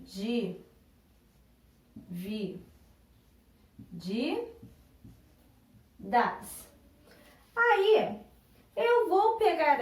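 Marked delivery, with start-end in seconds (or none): 0.0-6.7 low, soft syllables of "Di, vi, di, das" with long pauses; dictating by syllable. Shown as a demonstration. Teacher writing the word in the chart, "Di, vi, di, das" softly spaced out. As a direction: say "Di, vi, di, das" as if writing each one. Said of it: "eu vou escrever a minha palavra".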